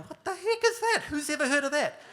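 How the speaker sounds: high, loud, laughing outburst; laughing disbelief. Retold or imitated. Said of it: "I'm going".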